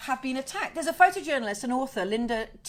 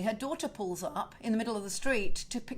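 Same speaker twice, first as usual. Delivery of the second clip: ordinary delivery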